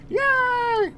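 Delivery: very high puppet-frog cheer; Kermit the Frog. Pretend. Streamer capping the impression with a cheer, Kermit voice. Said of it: "it's me, Kermit the Frog".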